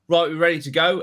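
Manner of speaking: mock cheery announcement. Pretending to leave, telling the golf group brightly, mock cheery. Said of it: "I'm gonna go".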